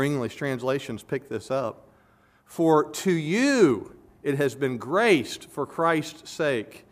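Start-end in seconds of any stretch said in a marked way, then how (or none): 2.5-6.8 emphatic reading, "you" stretched and lifted; stress on TO YOU. Shown as a demonstration. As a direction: lean hard and slow on "to you". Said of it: "emphatic sense of this goes like this".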